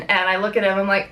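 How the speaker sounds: ordinary talk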